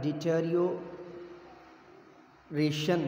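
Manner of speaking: slow, every syllable pulled apart. Shown as a demonstration. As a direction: stretch each syllable out slowly. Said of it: "six syllable हो गया जी".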